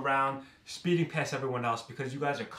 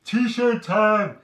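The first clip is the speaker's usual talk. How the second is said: high, loud drawn-out holler; Jersey Shore catchphrase yell; 9 semitones higher, 13 dB louder than usual. Retold or imitated. Imitating Jersey Shore cast for the viewers, hollering. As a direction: yell it like a party rallying cry. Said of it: "how they used to say".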